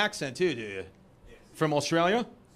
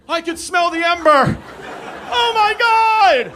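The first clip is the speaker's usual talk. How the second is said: much higher, much louder, slow hammed-up alarm; mock panic; 11 semitones higher, 13 dB louder, slower than usual. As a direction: shriek it like the smoke is reaching Brooklyn. Panicking over Australian fire smoke.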